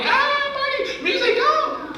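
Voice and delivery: mock the carefree freshmen, laughing; party freshmen.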